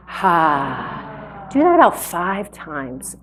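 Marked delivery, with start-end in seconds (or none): 0.1-1.5 long, low "ha" on an exhale; breathed-out "ha". Shown as a demonstration. Breath teacher showing the audience the ha sound, long exhale. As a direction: open the mouth and let out a drawn-out "ha". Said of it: "do the ha sound".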